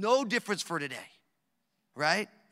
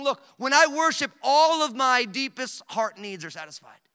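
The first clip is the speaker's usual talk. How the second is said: loud, higher preaching of the psalmist's words; fervent paraphrase; 6 semitones higher, 9 dB louder than usual. Retold or imitated. Preacher voicing the psalmist to the congregation, loud and high. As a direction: proclaim it like David crying out. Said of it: "He's saying".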